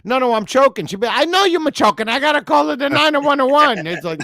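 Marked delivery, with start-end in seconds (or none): 0.1-0.8 high, louder mock plea; imitated misheard plea. Listener voicing what grandma misheard, mock alarm. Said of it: "She probably thought you said". 1.1-3.8 high, frantic mock Italian granny; Italian grandmother accent. Italian grandma fearing he is choking, mock-frantic Italian accent. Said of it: "Italian grandmother".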